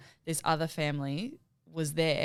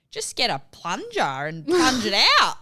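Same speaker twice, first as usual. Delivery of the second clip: louder, higher mock child voice; imitating a little kid; 5 semitones higher, 8 dB louder than usual. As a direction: do the country kid's confident advice. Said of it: "He's like".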